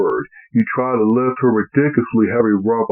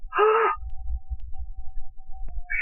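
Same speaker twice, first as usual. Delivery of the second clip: sharp breathy gasp of horror; horrified gasp; 9 dB quieter than usual. Acted. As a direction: gasp in shock. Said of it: "She gasped in horror".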